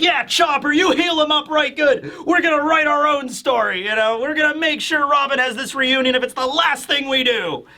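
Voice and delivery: high, fired-up acted pep talk; high-pitched rousing encouragement; rallying cry. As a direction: cheer a teammate on with hammed-up resolve. Usopp rallies the crew.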